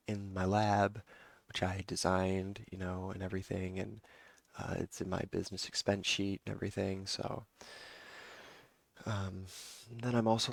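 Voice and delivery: normal talking voice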